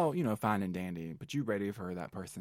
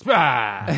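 loud mock exclaim; 3 semitones higher, 13 dB louder than usual.

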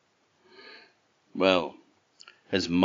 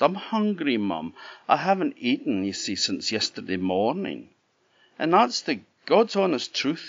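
put-on higher pleading boy's voice; Jack begging; 6 semitones higher than usual. A narrator's reading. Jack begs the giant's wife for breakfast, high and pleading. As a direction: beg like a hungry lad.

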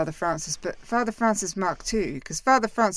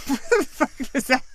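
laughing; 9 semitones higher, 5 dB louder than usual. Real, not acted. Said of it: "That's Ricky Gervais popping in there".

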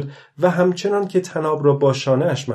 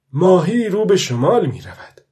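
raised voice, higher and louder; speaking aloud; 6 semitones higher, 6 dB louder than usual. A narrator's reading. Old fisherman alone at sea remarking on the fish's course, speaking aloud. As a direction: call it out aloud, pitched up. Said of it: "پیرمرد گفت".

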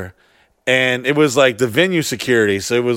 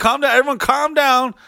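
high mock calm-down; 10 semitones higher than usual. Mocking someone's panic.

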